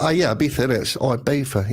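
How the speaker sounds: Ibiza, innit, in a light London accent. Shown as a demonstration. Londoner joking about how he says Ibiza, light London accent. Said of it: "I always say it with a London accent".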